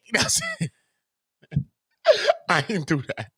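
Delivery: chuckling through the words; laughing. Real, not acted. Laughing after mock gossip.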